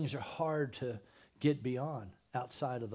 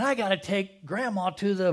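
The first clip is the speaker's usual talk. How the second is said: frantic errand; 7 semitones higher, 6 dB louder than usual. Acted. Acting hurried shopper driving fast.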